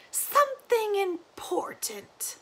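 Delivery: put-on slow high whisper of an old woman; secretive whisper. Granny confiding to Lina, whispered and slow. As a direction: hush it like a secret.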